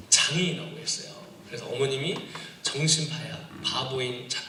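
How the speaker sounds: normal talking voice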